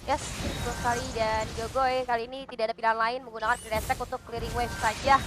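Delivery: normal talking voice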